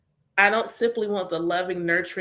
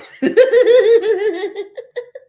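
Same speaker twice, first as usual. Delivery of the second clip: loud, high burst of laughter; big laugh; 11 semitones higher, 11 dB louder than usual. Real, not acted. Laughing at the healer's reaction, loud laugh. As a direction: let a big laugh explode.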